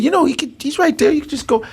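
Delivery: high playful imitation of the staff. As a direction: pitch it way up for the office staff. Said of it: "They said".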